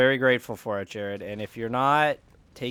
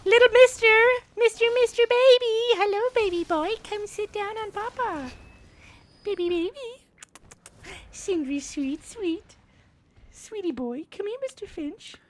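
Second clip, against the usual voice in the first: talking to pet. Real, not acted. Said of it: "putting on a voice talking to Sindri".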